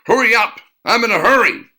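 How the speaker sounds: high, loud snapped demand; snapping. A narrator's reading. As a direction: snap it impatiently. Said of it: "She snapped again".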